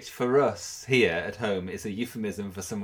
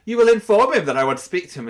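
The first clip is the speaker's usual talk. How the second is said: loud, high mock order; acted-out overbearing command; imperious demand; 8 semitones higher, 12 dB louder, faster than usual. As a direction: bellow it like a bossy official. Retelling an administrator's haughty demand.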